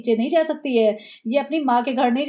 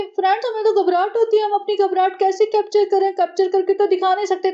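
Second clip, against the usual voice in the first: much higher, breathy put-on vlogger voice; mocking vlogger voice; 7 semitones higher than usual. Mocking the vlogger who cries on camera like her sister-in-law. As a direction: ham up a fake teary influencer. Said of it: "इसने कहा था कि".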